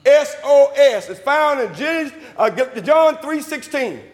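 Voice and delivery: ringing sermon delivery, stretched key words; full-force preaching. Acted. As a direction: preach it out to the whole church.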